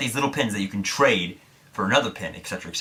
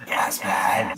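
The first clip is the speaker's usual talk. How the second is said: one more whispered name. Retold or imitated. Whispering her name once more.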